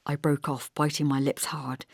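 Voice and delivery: plain reading voice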